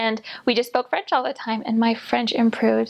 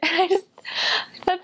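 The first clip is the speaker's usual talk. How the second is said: higher words coming out on a laugh; 7 semitones higher than usual. Real, not acted. Missionary recalling a blunt verdict on her French, laughing. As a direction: laugh as you try to go on.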